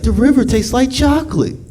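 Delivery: high-pitched put-on child voice; mock-sweet, high voice; awed film character. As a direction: marvel in a high little voice. Acts child in chocolate factory.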